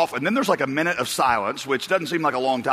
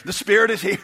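worship leader proclaiming; 5 semitones higher than usual. Retold or imitated. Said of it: "he kept saying".

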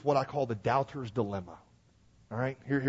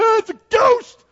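high, loud scream of fright; terrified shout; 15 dB louder than usual. Retold or imitated. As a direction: shriek as if seeing a ghost. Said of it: "They saw him and said".

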